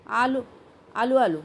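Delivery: short, repeated call back; reply hailed from a distance; answering call. A narrator's reading. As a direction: holler back that you're on the way. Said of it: "अशी साद आली".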